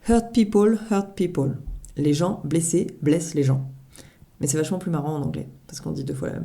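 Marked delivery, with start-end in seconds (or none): 0.1-1.6 attempted English accent; hammed-up English accent on the proverb; French speaker trying an English accent. Shown as a demonstration. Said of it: "j'essaye de sortir mon accent anglais".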